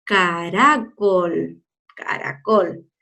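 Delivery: "caracol" slow and low with a gentle tapped R; soft R. Shown as a demonstration. Teacher repeating the word for pupils, soft slow R. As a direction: draw out "caracol", barely tapping the R. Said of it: "Entonces suena despacito".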